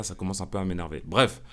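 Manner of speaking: ordinary talk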